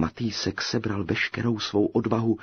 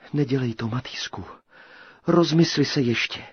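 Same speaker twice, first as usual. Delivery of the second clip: princess pleading; 5 semitones higher, 5 dB louder than usual. A narrator's reading. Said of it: "volala na Krejčíka prvosenka".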